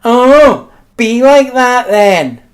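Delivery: mock offended mother snapping; huffy mum. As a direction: mum taking the huff at her child. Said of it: "she'd get upset".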